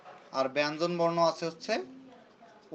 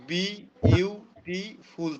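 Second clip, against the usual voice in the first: "বি ইউ টি ফুল" as four separate slow beats; four syllables; 2 semitones higher, 4 dB louder, slower than usual. Shown as a demonstration. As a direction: pause after each part of "বি ইউ টি ফুল". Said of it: "চারটা".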